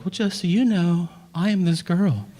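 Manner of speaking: high-pitched put-on girl introducing herself; dating girl's act. Girl answers guy while dating, high put-on act. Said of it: "And the girl's like".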